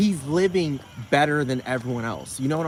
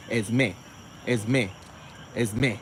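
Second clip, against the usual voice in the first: Esme with the final syllable stressed; "Esme" repeated, each with end stress; accent at the end; 3 semitones lower, much slower than usual. Shown as a demonstration. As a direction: lean on the last syllable of "Esme". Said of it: "the accent at the end".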